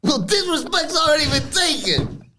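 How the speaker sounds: high mock Italian-American accent; Nunzio impression. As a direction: menace him like a mob boss. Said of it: "And Nunzio says".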